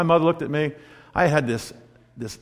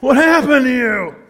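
mother's alarm; 8 semitones higher, 4 dB louder than usual. Retold or imitated. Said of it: "she went".

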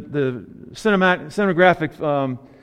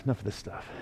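much softer, lower repeat of "enough of this stuff"; 8 semitones lower, 17 dB quieter, faster than usual. Retold or imitated. Preacher voicing Jesus ending the fuss, muttered aside. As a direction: drop the voice on the second "enough of this stuff". Jesus cutting off worried disciples.

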